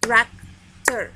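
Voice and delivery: "Tractor" as two separate beats, trac... tor; syllable demonstration. Shown as a demonstration. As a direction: pause between the syllables of "Tractor". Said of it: "Two syllables".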